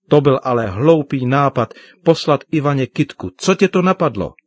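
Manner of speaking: loud, indignant sibling telling-off; cross, climbing to a high exasperated question; scolding. Acted. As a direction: challenge a brother's silly idea. Sister scolds brother for his idea.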